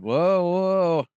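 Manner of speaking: jolted by bumps; on a bumpy road. Real, not acted. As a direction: voice bouncing over rough ground. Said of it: "sorry about the bumps".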